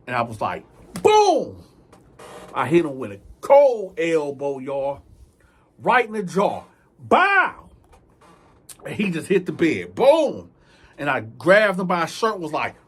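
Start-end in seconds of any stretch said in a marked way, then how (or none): 1.0-1.6 sharp loud boom; hit sound. Retold or imitated. Imitating the elbow strike. 7.0-7.7 high sharp bow; punch sound. Retold or imitated. Imitating the punch to the jaw. 9.9-10.5 loud boom; impact sound. Retold or imitated. Imitating him hitting the bed.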